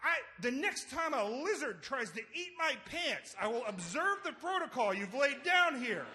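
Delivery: high-pitched exasperated retort; snapping back. Retold or imitated. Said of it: "that's when I snapped".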